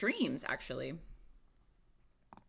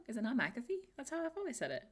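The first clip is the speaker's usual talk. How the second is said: ordinary delivery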